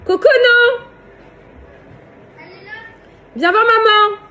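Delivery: high, loud call across the yard. Real, not acted. Calling the child from afar.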